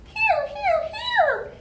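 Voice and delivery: very high tiny chirping; baby bird voices. Acted. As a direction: squeak like tiny baby birds. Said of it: "sang the little robins".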